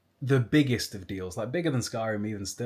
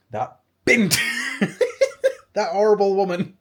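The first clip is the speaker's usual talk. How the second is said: mock outraged rant; 9 semitones higher, 9 dB louder than usual. Pupil ranting about hated teacher.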